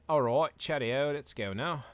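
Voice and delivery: mock-British rally cry; bad British accent. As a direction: put on a cheesy British accent. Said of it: "bad British accent".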